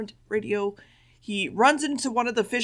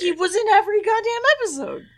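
high, louder protest; real exasperation, pitched way up; exasperated insistence; 13 semitones higher, 5 dB louder than usual. Real, not acted. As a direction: argue back in a raised, high-pitched voice. Exasperated argument over the villain.

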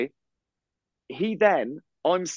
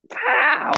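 mock pow; 14 semitones higher than usual. Deciding to report the man.

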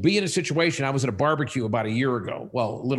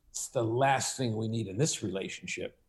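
lightly voiced chest-out bravado, a touch lower and softer; puffed-up macho neighbour; 5 semitones lower, 8 dB quieter than usual. Neighbour brushing off the product, lightly voiced macho pride. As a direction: puff the chest and play it proud, understated. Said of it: "Puffs his chest out".

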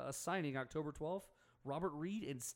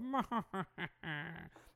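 sinister mad cackle; villain's gloating laugh; evil scientist laugh; 3 semitones higher than usual. Pretend. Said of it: "That's my evil scientist voice".

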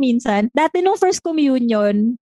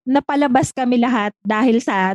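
usual speaking voice

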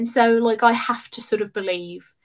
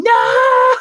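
high, loud anguished cry of no; retold scream; 10 dB louder than usual. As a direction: belt out the no in despair.

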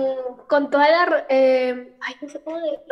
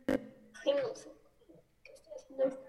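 muttering to herself; 12 dB quieter than usual. Real, not acted. Caller flustered, aside to herself.

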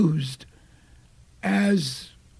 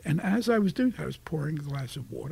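ordinary talk, nothing marked

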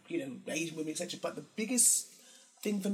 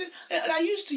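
normal talking voice

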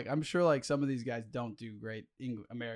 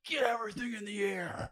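much higher, strained movie-character impression; Josh Brolin; 9 semitones higher than usual. Podcaster mimicking a favourite actor for co-host, high put-on voice. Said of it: "I love doing his voice".

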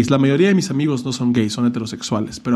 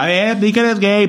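mock pushy demand; 8 semitones higher than usual. Mocking someone forcing a friend out, high and taunting. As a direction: sneer it like a bully.

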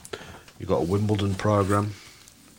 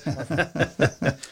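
short laugh; 9 semitones higher than usual. Real, not acted. Laughs at own pipe sound.

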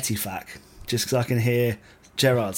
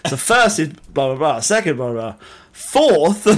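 mock quote; 3 semitones higher, 8 dB louder than usual. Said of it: "She literally just went".

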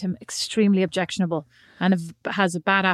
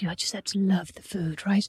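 imitating the chef; 3 semitones lower, 6 dB quieter than usual. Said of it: "He goes".

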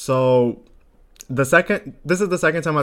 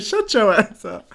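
tag; 8 semitones higher, slower than usual. Retold or imitated. Retold comeback, high and drawn out.

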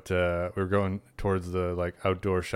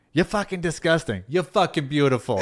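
parody of South Shore women; 7 semitones higher, 3 dB louder than usual. Retold or imitated. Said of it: "The parody".

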